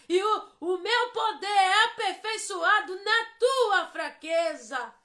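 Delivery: loud, high, slow proclaiming as the Lord; voice of God. Retold or imitated. Preacher voicing the Lord to the weary believer, loud and slow. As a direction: thunder it out like a divine promise, stretching each phrase. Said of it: "e falando assim".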